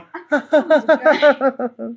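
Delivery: high imitated giggle; a high put-on giggle; imitated laugh. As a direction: mimic a friend's cute laugh, high. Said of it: "she's probably sitting there going".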